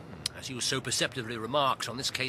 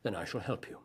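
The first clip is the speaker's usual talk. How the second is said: normal talking voice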